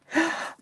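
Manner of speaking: sudden shocked intake of breath; sharp gasp. Real, not acted. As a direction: gasp in real alarm. A mistake just noticed.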